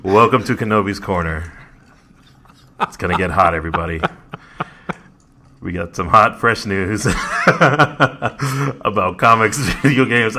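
loud seductive intro, cracking up; sexy voice breaking into laughs. Pretend. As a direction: try to sound sexy but keep laughing. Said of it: "It was trying to be, but I couldn't stop laughing".

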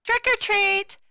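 loud, high excited yell; kids yelling. A narrator's reading. Kids at the vampire's door, yelling high and loud. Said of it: "the kids who yelled".

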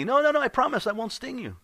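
very high, fast, loud scorpion voice; insistent scorpion. Acted. Scorpion swears to frog it will not sting, insistent. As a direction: rattle off the promise up high.

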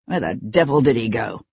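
lightly voiced low male pursuer; pursuer's voice. A narrator's reading. Pursuer above has lost the fugitive, lightly voiced low question.

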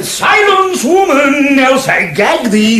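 mock stern shout. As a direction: shout her down like a pompous jailer. Toad silencing the gagged washerwoman.

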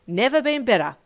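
loud, bitter angry retort. A narrator's reading. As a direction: spit it out angrily. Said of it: "you replied angrily".

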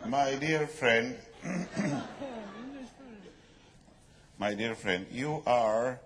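put-on slow, halting English with a Spanish accent. Colleague correcting his dear friend, halting Spanish-accented English. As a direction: pronounce English deliberately through a Spanish accent.

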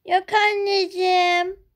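put-on a girl feigning innocence to mother; sweet little girl. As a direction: play the innocent daughter.

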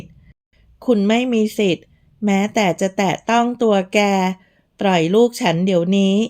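ordinary delivery, nothing marked

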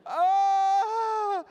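high, loud, sustained mock scream; long comic wail of despair; exasperated scream. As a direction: scream "ah" at the last straw. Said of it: "And you're".

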